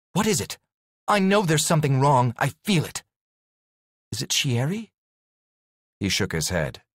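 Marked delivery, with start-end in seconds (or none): 0.1-4.9 worried pleading. Acted. Heroine anxiously presses Douglas.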